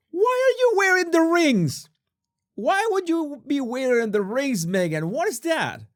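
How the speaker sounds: high-pitched exasperated outburst; mock outrage. Host to Megan about wearing rings while cooking, mock outrage. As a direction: shriek the question in mock disbelief.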